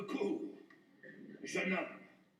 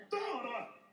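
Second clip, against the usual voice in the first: soft, quick and hushed; tender; 4 dB quieter than usual. Acted. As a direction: coo it gently, nearly murmured.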